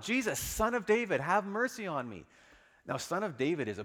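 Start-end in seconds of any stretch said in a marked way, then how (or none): 0.0-2.3 high, loud plea; calling out. Acted. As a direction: call out into the crowd. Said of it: "he's calling out".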